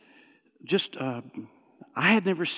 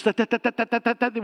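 stuttering; 5 semitones higher than usual. Retold or imitated. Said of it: "this stuttering stuff".

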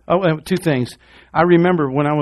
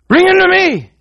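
higher and louder, a pulpit cry; preached shout; 7 dB louder than usual. Acted. Preacher calling the hurting to Jesus, shouting. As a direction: call it out over the whole church.

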